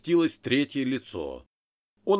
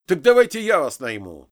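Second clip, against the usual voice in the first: loud, higher, impulsive outburst; heated blurt; 4 semitones higher, 9 dB louder than usual. A narrator's reading. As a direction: blurt it out in a temper. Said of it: "в сердцах, не подумав, брякнул я".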